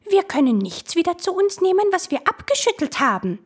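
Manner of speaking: high mocking reply of the wisps; will-o'-wisp voice. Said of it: "versetzten jene".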